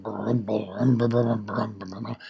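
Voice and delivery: low whispered rumble; rumbling volcano. Acted. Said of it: "The volcano is rumbling".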